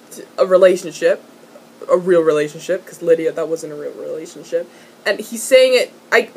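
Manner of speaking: usual speaking voice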